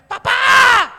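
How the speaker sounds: put-on high, loud cry of a child running to dad; a child's glad yell of ¡Papá! across the room; child's joyful shout. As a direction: shout it like a kid greeting his father.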